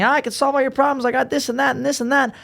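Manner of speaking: mock salesman pitch. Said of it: "instead of saying".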